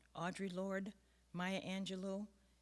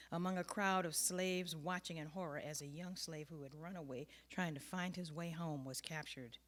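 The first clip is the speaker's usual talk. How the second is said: usual speaking voice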